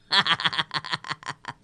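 staccato string of laughs; laughter. Real, not acted. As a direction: laugh in a quick, even rattle. Se ríe de su chiste. (carcajada entrecortada)

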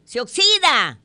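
high, loud shouted answer; answer from back row. Acted. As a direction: call it out as if from far back. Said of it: "De la última fila alguien le responde". (respuesta gritada, aguda, desde atrás)

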